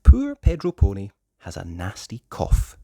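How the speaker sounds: plain reading voice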